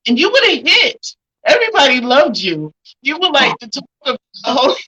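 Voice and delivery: excited gushing. Real, not acted. Host gushing with emotion.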